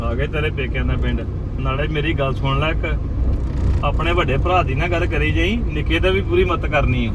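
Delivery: loud, insistent scolding; heated warning. Acted. Husband to wife at her parents' village, loud heated warning.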